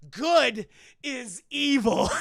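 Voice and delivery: loud, slow portentous line; dramatic declaration. Retold or imitated. Sam revealing the Good family, slow and loud. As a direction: announce it with mock gravity. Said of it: "And she goes".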